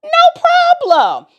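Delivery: overeager, high-pitched yes, covering a lie; hammed-up eager acceptance; mock eager. Telling her sister with mock eager confidence. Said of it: "I use this as an opportunity".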